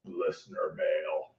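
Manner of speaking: very low, hushed, slow put-on voice; over-the-top segment title in a quiet, deep voice; extra, hammed-up echo. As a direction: drop deep and soft, stretching it out. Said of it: "Wow, that was extra".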